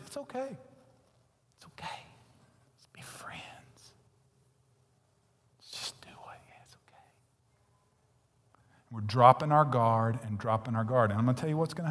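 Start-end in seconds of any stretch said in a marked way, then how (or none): 0.1-7.0 coaxing unvoiced whisper. Preacher voicing temptation to the congregation, coaxing whisper. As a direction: whisper it like a tempter luring you in.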